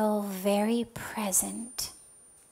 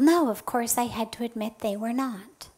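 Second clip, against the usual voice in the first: ordinary talk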